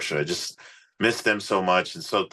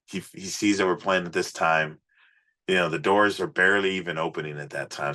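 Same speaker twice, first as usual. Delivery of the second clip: normal talking voice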